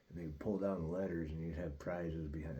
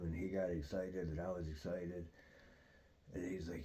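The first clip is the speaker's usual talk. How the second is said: normal talking voice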